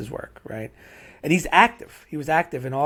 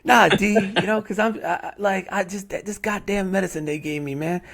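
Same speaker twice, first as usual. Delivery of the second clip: put-on high-pitched old man's excuse; older man calling in; 7 semitones higher than usual. Chef explaining his sick day by phone, high-pitched old man. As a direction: plead sick in a higher voice, stumbling over the words.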